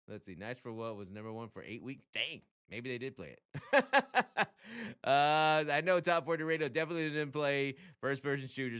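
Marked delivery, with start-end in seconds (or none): 3.9-5.0 high, loud chuckle; amused laugh. Real, not acted. Host caught out on [name]'s hit, amused laugh. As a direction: crack up at yourself.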